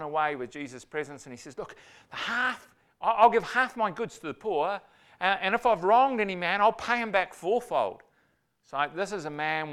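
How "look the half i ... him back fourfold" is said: lightly voiced eager Zacchaeus, higher pitch; a hint of an overjoyed tax collector making promises; higher, louder, eager promise. Zacchaeus voiced in retold story.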